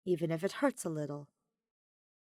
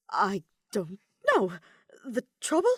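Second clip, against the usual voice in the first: stuttering; 3 semitones higher, 6 dB louder than usual. Acted. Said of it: "Kaveh stutters".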